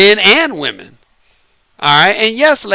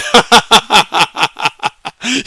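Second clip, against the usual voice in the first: hearty chuckle; laugh; 2 semitones higher than usual. Real, not acted. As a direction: burst out laughing. Laughs at own joke.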